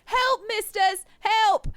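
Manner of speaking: high, loud fake cry for help; bratty kid yelling. Kuno yelling to passersby, high mock alarm. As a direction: shriek it like a brat faking distress.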